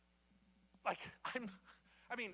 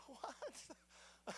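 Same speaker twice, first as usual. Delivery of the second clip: a higher, breathy drawn-out what; mock stunned what; 8 semitones higher, 9 dB quieter than usual. Kid in Sunday school hearing Moses barred, stunned. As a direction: let the what hang, quietly baffled. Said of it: "I'm like".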